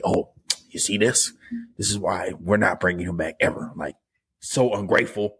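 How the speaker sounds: calm menacing drug lord; Kingpin voice. Retold or imitated. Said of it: "Why am I talking like Kingpin?".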